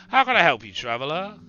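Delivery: loud, hearty Scottish innkeeper greeting; a burly Scot welcoming a stranger; heavy Scottish accent. Acted. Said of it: "His accent is heavy".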